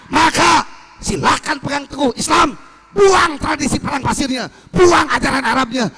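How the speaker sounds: loud, high proclamation to the crowd; shouting again. Retold or imitated. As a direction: bellow it as a fiery command. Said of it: "Terus teriak lagi". (teriakan keras penuh semangat)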